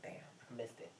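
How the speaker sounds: breathy whisper of a left-out guest; whispered aside. Retold or imitated. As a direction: whisper it to yourself, as if missing the joke. Retold confusion at the table.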